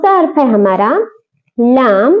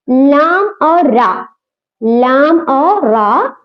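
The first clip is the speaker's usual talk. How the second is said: लाम और रा said very slowly; teacher stretches each letter out; slow, drawn out; much slower than usual. Shown as a demonstration. Teacher names the two letters slowly for students. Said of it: "कभी बारीक पढ़ती".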